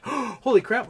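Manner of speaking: high, loud gasp of surprise; gasp, then blurt it out; surprised gasp. Real, not acted. Gasps at a big donation.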